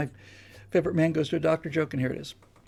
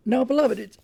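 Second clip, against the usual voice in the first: put-on young Irish priest; 3 semitones higher than usual. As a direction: address the country congregation as an earnest young priest.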